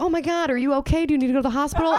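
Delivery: high, worried fussing; hammed-up panic, pitched high; mock alarm. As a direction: play alarmed concern for a sick person.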